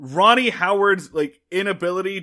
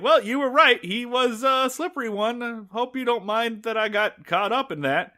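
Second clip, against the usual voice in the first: higher-pitched mock apology; lightly voiced, raised-pitch apology; sheepish Barney; 7 semitones higher than usual. Barney apologizing to Andy, lightly acted sheepishness. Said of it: "Barney's like".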